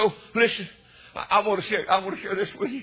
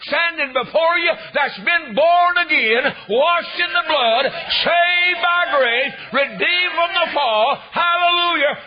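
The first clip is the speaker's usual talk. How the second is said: high, loud, drawn-out preacher's shout; fervent revival holler; full-force preaching; 8 semitones higher, 6 dB louder, slower than usual. Real, not acted. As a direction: belt out each phrase slowly to the congregation.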